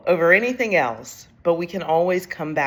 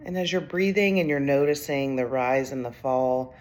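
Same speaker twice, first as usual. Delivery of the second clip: guided meditation; 7 dB quieter than usual. Acted. Coach has leaders notice rise and fall of breath, soft guided meditation. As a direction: ease them into noticing the breath, softly.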